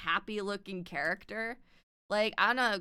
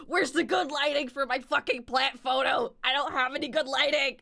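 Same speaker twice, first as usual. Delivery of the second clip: loud, high, hammed-up exasperation; mock frantic yell; 6 semitones higher, 5 dB louder than usual. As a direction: rant in playful desperation. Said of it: "run around my house like".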